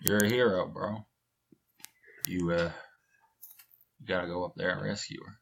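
easy, laid-back Texan hero talk; McConaughey as Luigi. Acted. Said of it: "Matthew McConaughey as Luigi".

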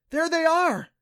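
high, loud cry of discovery; startled, high-pitched outburst; breathed exclamation. Acted. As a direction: exclaim it high, wide-eyed with amazement. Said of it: "she breathed".

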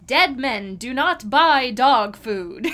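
slow, loud and weighty, mimicking the film line; mock-ominous, deliberate delivery; emphatic quoted warning. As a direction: land DEAD MEN DO NOT BUY DOG FOOD word by word. Quoting villain's warning from film.

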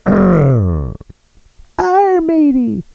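put-on pirate accent pitched far up, loud; high pirate girl voice. Comic trying the pirate and girl voice at once, high pirate accent. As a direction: squeal it like a girly pirate. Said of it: "do the pirate and the girl voice".

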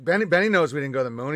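normal talking voice

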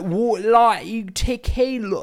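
high, loud, drawn-out mock Brit; drunk British mimicry. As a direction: imitate a wasted British guy's garbled speech. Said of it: "his voice is so".

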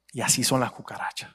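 normal talking voice

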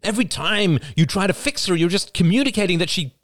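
high, heated mother pleading; rising, near-shouting defence. Acted. Mother defending herself hotly against her son.

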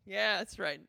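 ordinary talk, nothing marked

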